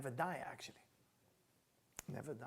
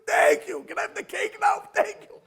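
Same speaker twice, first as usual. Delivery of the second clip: put-on very high, loud toddler begging; imitating a three-year-old; 14 semitones higher, 9 dB louder than usual. Small child wanting cake, high shouted mock thanks. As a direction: shout it like a kid after cake. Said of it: "You ever saw a baby say thank you".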